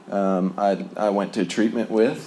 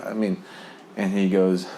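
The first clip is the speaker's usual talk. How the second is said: ordinary delivery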